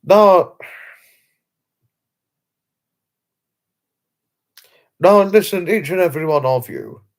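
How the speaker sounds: rally everyone's attention loudly; calling for attention. Real, not acted. Streamer calls out to his viewers loudly.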